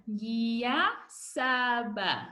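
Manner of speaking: "Ngiyasaba" very slow and syllabled; slow drill word. Shown as a demonstration. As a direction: lead the class through "Ngiyasaba" slowly. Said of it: "practice this word together".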